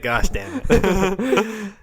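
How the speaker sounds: drawn-out exclamation. Retold or imitated. Mock triumphant exclamation.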